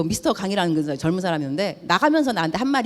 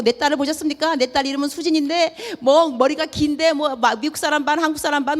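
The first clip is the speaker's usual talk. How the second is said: higher, urgent frantic mother; frantic plea; 6 semitones higher than usual. Retold or imitated. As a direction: ask everyone desperately for the lost daughter. Frantic mother searching for her daughter. (잃어버린 딸을 찾아 사람들에게 묻는 다급한 엄마)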